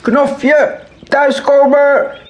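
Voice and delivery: zeer hoge, gerekte luide roep; keihard schreeuwend. A narrator's reading. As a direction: schreeuw je kind naar huis. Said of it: "schreeuwt keihard". (keiharde, langgerekte schreeuw)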